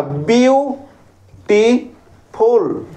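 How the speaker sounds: "বিউটিফুল" very slowly in three separate beats; drawn-out three-part "বিউটিফুল", pausing between syllables; broken into syllables. Shown as a demonstration. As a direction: stretch and split "বিউটিফুল" as bee-u, tee, ful. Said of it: "তিনবার ভেঙে উচ্চারণ করলাম".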